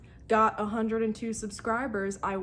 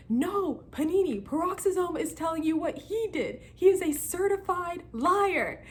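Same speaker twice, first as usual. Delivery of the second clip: much higher, pleading counter-accusation; heated denial; 10 semitones higher than usual. Retold or imitated. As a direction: turn the blame back, voice up high. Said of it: "we're just arguing".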